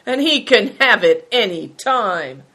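emphatic defiance; defiant cry. A narrator's reading. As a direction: finish the threat boldly. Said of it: "he cried".

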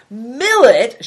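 loud indignant shout of a single word. A narrator's reading. A hungry guest outraged at being served millet, loud shout. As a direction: bellow it in outrage. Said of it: "shouted Good Fortune".

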